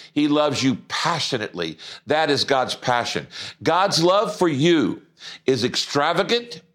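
usual speaking voice

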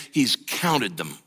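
ordinary talk

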